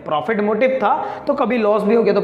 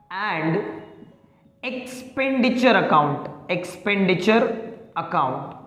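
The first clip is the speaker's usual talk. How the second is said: slow, softer, each syllable stretched; slow dictation; 5 dB quieter, much slower than usual. Shown as a demonstration. Teacher dictating the account name, slow and soft. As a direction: draw out expenditure for note-taking. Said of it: "next we have what?".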